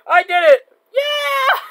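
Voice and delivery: very high, loud victory yell; triumphant shout. Real, not acted. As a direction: scream with joy at winning. Survives the night.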